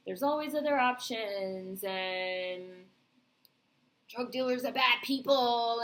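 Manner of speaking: slow, drawn-out mock preachy line; mocking platitude. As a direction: parrot a tired moral, stretching the words. Mocks the usual TV framing.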